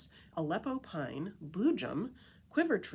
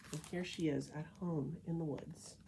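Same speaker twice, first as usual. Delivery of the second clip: usual speaking voice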